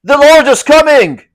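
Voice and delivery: high, loud warning call; urgent announcement. A narrator's reading. Vassal warns the others that the lord approaches, loud and high. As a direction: warn everyone the lord is here. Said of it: "approached and said".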